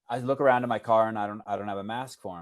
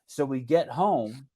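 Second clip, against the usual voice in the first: usual speaking voice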